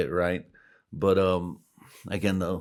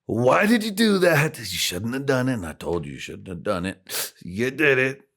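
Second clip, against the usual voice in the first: loud, high scolding voice; mock president scolding; Trump scolding; 6 semitones higher, 6 dB louder than usual. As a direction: tell him off like Trump. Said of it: "Trump was like".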